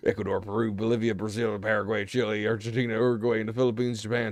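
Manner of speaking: reading aloud in a put-on American accent. Streamer reads an encyclopedia entry to viewers, American accent. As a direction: rattle the list off in an American accent. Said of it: "you're speaking in an American accent".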